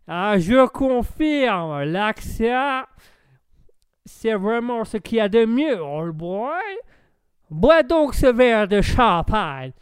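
put-on loud, drawling American-accented French; hammed-up tipsy American, high and slow. American urging the host to drink champagne, booming American accent. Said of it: "ce sera top pour l'accent".

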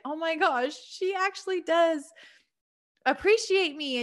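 high-pitched happy realization; touched amazement. Retold or imitated. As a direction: retell a delighted, sudden realization. Acts out her delighted realization.

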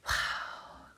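breathless, whispered wow; awed whisper. Retold or imitated. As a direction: let the wonder escape in a hush. Hushed awe at the sunset memory.

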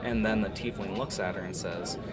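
usual speaking voice

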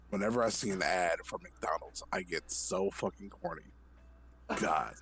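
low, soft, mock-lustful confession; committed dramatic reading. Reader confessing a food craving to friends, low and hushed.

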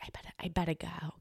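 quick unvoiced whisper. Excusing herself from her ex in a quick whisper. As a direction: hurry it out in a hush.

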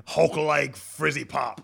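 slow dumb Hulk talk. Pretend. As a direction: grunt it like a brute. Hulk caveman voice bit.

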